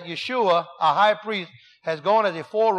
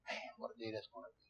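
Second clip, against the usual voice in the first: awed hush; 9 semitones lower, 23 dB quieter, faster than usual. Real, not acted. Awed aside about Christ's return.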